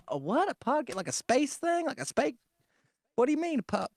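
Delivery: high, small puzzled voice; baffled neighbor. Retold or imitated. Older diners asking what a podcast is, baffled high voice.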